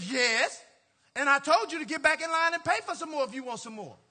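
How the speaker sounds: firm loud refusal. Retold or imitated. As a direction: shut the customer down hard.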